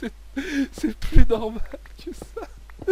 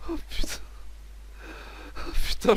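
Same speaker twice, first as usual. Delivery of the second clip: wheezing through helpless laughter; gasping out words between laughs; crying with laughter; 7 semitones higher, 5 dB quieter than usual. Real, not acted. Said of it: "j'en pleure de rire". (en pleurant de rire, à bout de souffle)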